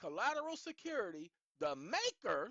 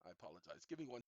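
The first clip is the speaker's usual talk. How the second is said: normal talking voice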